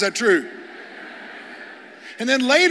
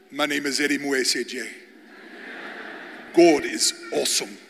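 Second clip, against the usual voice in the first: Pastor Eddie impression; 7 semitones lower, 5 dB quieter than usual. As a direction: drop deep and measured, imitating a pastor. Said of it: "this is what he sounds like in my Mzungu mind".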